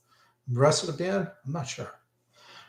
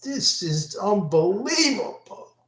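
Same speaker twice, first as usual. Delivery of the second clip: amazed; 9 semitones higher than usual. Retold or imitated. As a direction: gasp it out in disbelief. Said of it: "I'm like".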